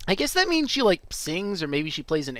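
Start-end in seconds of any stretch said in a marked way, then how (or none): none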